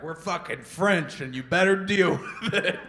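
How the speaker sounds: loud, high, hammed-up militant outburst; mock defiance. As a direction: play a defiant separatist, breaking into laughter. Voicing militant separatists, half laughing.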